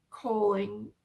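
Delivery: ordinary delivery, nothing marked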